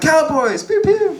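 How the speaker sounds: very high squeaky cowboy guns; mock gun-happy fan. Woman miming cowboy shootouts in a high squeal. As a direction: squeak the pew pews like a toy gun. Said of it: "She was like".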